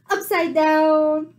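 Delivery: usual speaking voice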